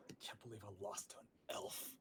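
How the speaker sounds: low, soft whispered grumble; mumbling to himself. Acted. Goblin walking away, grumbling under his breath about losing. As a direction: mutter it under your breath. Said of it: "mumbling to himself".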